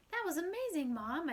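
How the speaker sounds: high, loud burst of admiration; gush, starting high and coming down; excited praise. Acted. Son to his mom after her egg sale, excited.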